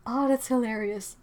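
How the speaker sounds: ordinary talk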